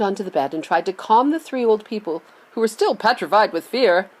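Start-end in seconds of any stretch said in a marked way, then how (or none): none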